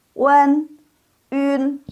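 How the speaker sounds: Wen, un as wen and ün, high and level; model wen, ün. Shown as a demonstration. Teacher models the two sounds, high and spaced. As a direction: sustain Wen, then purse lips for un. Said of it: "yang ini hanya dua".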